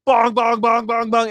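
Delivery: high, loud rapid bonging; imitated church bells. Complaining about bells next door, mocking clangs. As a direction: clang out bell strokes with your voice. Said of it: "all those stupid bells".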